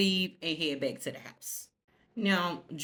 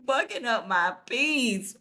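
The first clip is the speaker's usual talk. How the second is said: louder, laughing complaint; laughing through words; 6 semitones higher, 5 dB louder, slower than usual. Real, not acted. Vlogger laughing while quoting the annoyed son.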